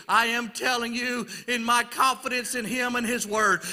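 ordinary talk, nothing marked

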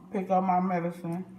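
normal talking voice

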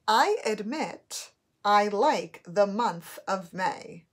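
slow, clear dictation, loud and deliberate. Shown as a demonstration. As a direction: read it out word by word for spelling. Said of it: "Your first sentence is".

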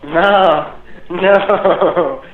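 ordinary talk

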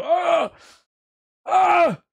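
high yelp of fright; startled shout. Real, not acted. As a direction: jump at the scare. Sudden scare mid-sentence.

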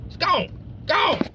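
high, gleeful gone; giddy delight. Acted. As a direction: squeal as the number climbs. Watching gas pump number climb.

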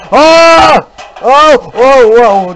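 real fright, jolting out a string of yelps; startled yelps. Real, not acted. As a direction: jump scare yelps, shrinking one by one. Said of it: "you didn't hear me scream".

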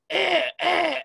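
high hoarse coughing croak; raven croak. Shown as a demonstration. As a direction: croak like a sore-throated raven. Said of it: "sounds like it has a sore throat".

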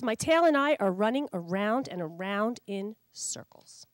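usual speaking voice

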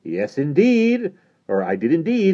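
loud, high, drawn-out self-satisfaction; bubbling over, pleased with himself; triumphant glee. A narrator's reading. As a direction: crow with delight, stretching the words. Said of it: "bubbling over with self-satisfaction and triumphant glee".

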